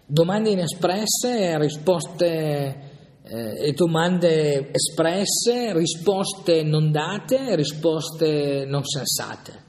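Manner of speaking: x0.5 slow, each phrase set apart; slow, weighted listing. Real, not acted. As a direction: lay out each contrast slowly, letting it sink in. Slow reflective list of contrasts.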